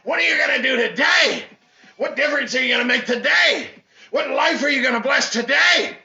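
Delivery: loud, high, urgent exhortation; rally the crowd like a preacher; shouted motivational sermon; fiery preaching. Real, not acted.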